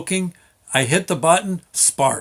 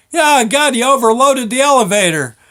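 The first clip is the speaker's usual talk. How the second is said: exasperated yelling; 10 semitones higher, 7 dB louder than usual. Retold or imitated. Foreman scolding up shaft.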